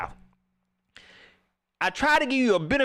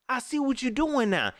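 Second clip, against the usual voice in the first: ordinary delivery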